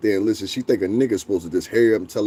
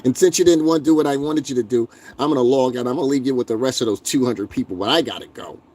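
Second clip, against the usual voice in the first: mocking chatter voice; 3 semitones higher than usual. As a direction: sneer like a smug troll. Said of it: "That's how these people sound to me".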